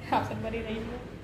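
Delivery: धीमी आवाज़ में विदा; quiet sign-off. Real, not acted. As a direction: murmur the goodbye. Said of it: "एकदम धीरे धीरे बोल रही हूँ".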